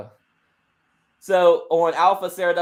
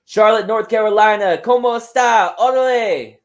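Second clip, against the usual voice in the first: loud, higher shout to the arena; hyped wrestling promo; 3 semitones higher, 8 dB louder than usual. Acted. Wrestler hails the Charlotte crowd, hyped and loud. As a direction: greet the crowd at full volume.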